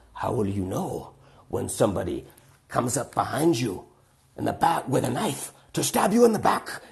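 put-on German-accented old lady, rising to a high pitch; old German woman. Old woman warns two boys about knife attacks, animated German accent. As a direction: imitate an elderly German woman building in animation. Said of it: "eighty-year-old German woman".

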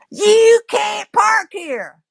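high, drawn-out mock-angry bark; indignant high-pitched accusation; sharp, accusatory. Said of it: "accusatory voice".

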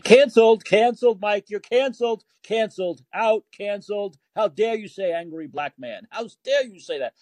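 high-pitched scolding critic; mock outrage. Critics canceling the host over a headline, mock outrage.

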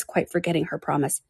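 plain reading voice

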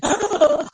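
sharp high gasp over a laugh; startled fangirl gasp; high excited gasp. Retold or imitated. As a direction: gasp like the scene just stunned you. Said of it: "'cause I kept gasping".

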